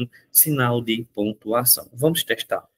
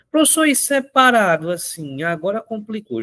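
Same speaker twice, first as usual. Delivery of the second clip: higher, puzzled student voice; a lightly voiced pupil, complaining it got hard; confused student; 8 semitones higher than usual. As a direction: play the student who just got lost.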